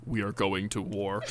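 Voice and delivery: low, slow ominous declaration; ominous chimp voice. Acted. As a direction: deliver it dead serious. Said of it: "he goes".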